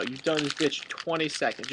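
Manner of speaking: ordinary talk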